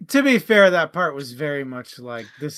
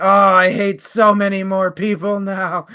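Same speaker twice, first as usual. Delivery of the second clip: laughing, raised whiny grumble; mock depressed hive mind; 5 semitones higher, 9 dB louder than usual.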